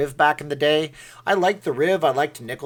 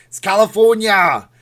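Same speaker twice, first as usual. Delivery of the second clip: loud, high Austrian strongman yell; Arnold; 7 semitones higher, 11 dB louder than usual. Shown as a demonstration. Streamer teaching viewers to yell as Arnold, shouted impression. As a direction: yell it as a hammed-up action hero. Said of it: "you can start yelling as Arnold".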